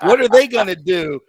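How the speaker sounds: loud, high, incredulous mock rant; half-laughing outraged retelling; exasperated retold rant. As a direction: bellow it like you can't believe the question. Retold own reply, laughing.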